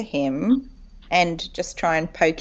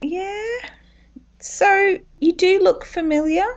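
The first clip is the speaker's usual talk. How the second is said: raised, brisk adventurer voice; playing it bright and quick; high character voice; 13 semitones higher, 4 dB louder than usual.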